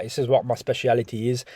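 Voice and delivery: normal talking voice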